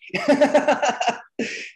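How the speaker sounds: high burst of real laughter; big laugh. Real, not acted. Cracking up at the editor's blunt question, real laugh. Laughing at his own punchline.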